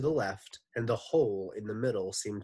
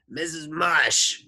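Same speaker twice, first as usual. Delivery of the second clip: slow, higher, louder lady voice; Missus Mush voice; 8 semitones higher, 9 dB louder, slower than usual. Acted. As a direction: call through the door.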